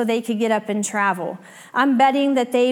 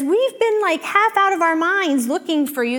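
frantic mother; 5 semitones higher than usual. Retold or imitated. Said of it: "Mary says".

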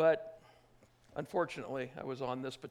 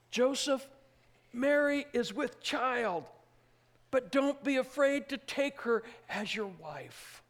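higher, slow, ringing call to Joseph; angel's announcement; 8 semitones higher, 4 dB louder than usual. Acted. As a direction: proclaim it like a heavenly messenger.

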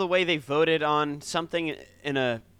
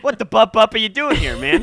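silly loud parody; 4 semitones higher, 6 dB louder than usual. Pretend. Playful bup-up parody.

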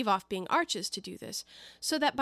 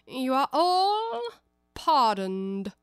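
king's voice; 3 semitones higher, 6 dB louder, much slower than usual. Acted. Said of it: "in a low voice".

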